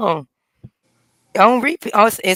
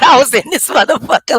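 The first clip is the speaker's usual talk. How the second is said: ordinary delivery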